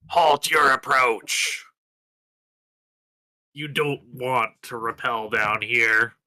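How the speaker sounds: loud in-character warning to halt; commanding yell. Acted. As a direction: call out a firm order, drawn out. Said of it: "in his most commanding, yelling voice".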